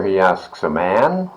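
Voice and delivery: plain reading voice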